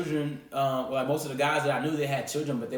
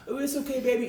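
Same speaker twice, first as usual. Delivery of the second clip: much higher, sing-song cooing; mock baby talk; 10 semitones higher, faster than usual. Father mocks mother babying their son in high cooing voice. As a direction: coo like she's soothing an infant. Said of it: "now that you're like".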